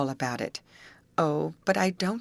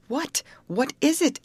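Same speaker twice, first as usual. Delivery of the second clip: plain reading voice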